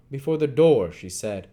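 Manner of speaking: ordinary delivery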